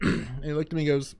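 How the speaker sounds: usual speaking voice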